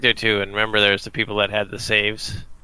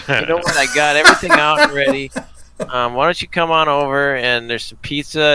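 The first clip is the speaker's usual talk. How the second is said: high, much louder fake-cheery invite; mock cheerful host; 4 semitones higher, 4 dB louder than usual. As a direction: oversell the welcome like a sitcom dad. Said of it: "it would have been funny if you're like".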